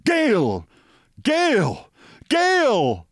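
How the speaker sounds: high shouted calls; shouting a name. A narrator's reading. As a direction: call out high and urgent.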